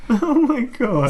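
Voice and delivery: high, slow laughing oh my God; laughing shock. Real, not acted. Co-host reacting to the Ewok story, laughing. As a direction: crack up in disbelief.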